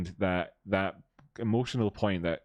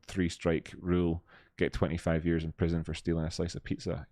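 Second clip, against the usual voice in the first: ordinary delivery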